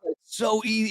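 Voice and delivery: normal talking voice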